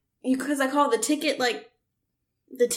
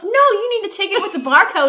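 higher mock-patient clerk; 7 semitones higher, 4 dB louder, faster than usual. Mimicking the box office guy, higher mock-patient. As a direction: explain it like a smug clerk. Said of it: "he goes".

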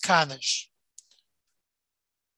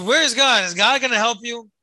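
higher, louder taunting jeer; mocking; 5 semitones higher, 5 dB louder than usual. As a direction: sneer it like a taunt.

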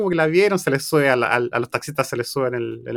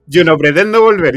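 louder, firm no as the wife; retold loud refusal; 8 dB louder than usual. Wife refusing to go back, loud retold refusal. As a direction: push it out, refusing flatly.

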